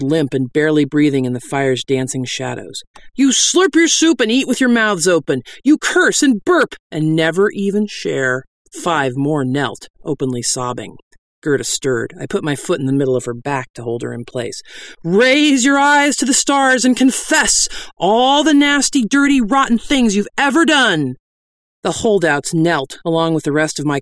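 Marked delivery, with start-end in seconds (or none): 3.1-8.4 high, scolding sermon; preach. Acted. 15.0-21.1 high, full-voiced call to confess; preach.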